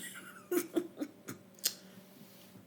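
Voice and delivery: high breathy laugh. Real, not acted. Man laughs about his stolen hat, high laughter.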